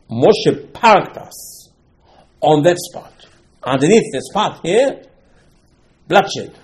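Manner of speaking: drawn out, heavy emphasis; slow loud preaching. Acted. Preacher telling the congregation where Moshe parked them, slow and loud. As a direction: preach each word slowly and loudly.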